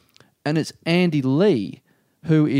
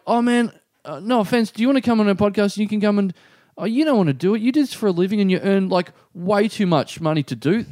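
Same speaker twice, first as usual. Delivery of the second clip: high, rushed, awkward backpedalling; bundle of nerves; 6 semitones higher than usual. Retold or imitated. Nervous man inviting a famous acquaintance onto his podcast, bundle of nerves. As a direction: blurt the invitation, then nervously retract it. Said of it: "turned you into a bundle of nerves".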